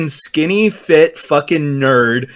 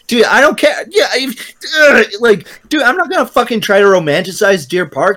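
whiny exasperated rant; 8 semitones higher than usual. Real, not acted. Frustrated ranting, crying sound.

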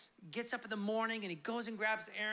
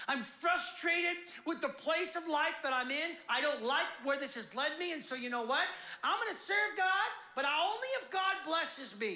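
raised, forceful venting; frustrated outburst; 8 semitones higher, 4 dB louder than usual. Acted. Disillusioned believer sets terms for God, frustrated. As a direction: protest as a let-down believer.

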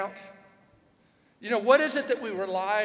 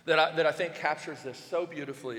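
usual speaking voice

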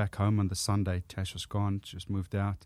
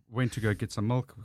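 normal talking voice

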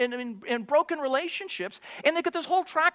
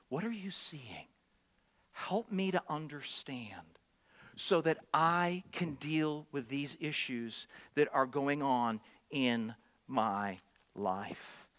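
soft, low, slow humble plea; gentle asking; 11 semitones lower, 9 dB quieter, slower than usual. Shown as a demonstration. Believer humbly asking to understand in faith. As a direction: ask quietly for help understanding. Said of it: "God wants us to respond".

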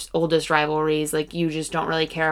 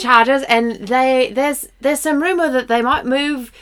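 higher-pitched mock British delivery; put-on British accent; 6 semitones higher, 4 dB louder than usual. Co-host hinting at a move to London, put-on British accent. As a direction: keep up a hammed-up London accent, halting as you go.

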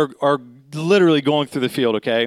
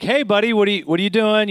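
higher, casual officer's question; casual probing; 6 semitones higher, faster than usual. Retold or imitated. Officer sizing up a man walking at night, casual probing. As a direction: imitate a cop casually feeling someone out. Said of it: "he's just kinda feeling him out".

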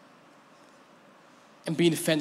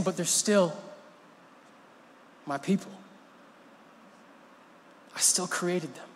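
softer, gentle pleading; tender God; 6 dB quieter than usual. Acted. Preacher voicing God defending his people, soft and tender. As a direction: say it like a father about his children.

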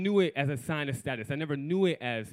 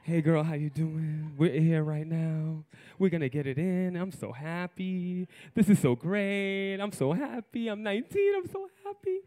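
giddy inner voice; 2 semitones higher than usual. Acted. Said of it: "This is all in my head".

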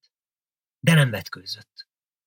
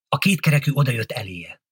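usual speaking voice

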